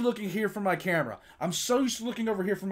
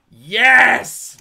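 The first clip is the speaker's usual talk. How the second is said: shouted yes; triumphant yell; loud cheer; 3 semitones higher, 13 dB louder than usual. Real, not acted. Streamer cheers.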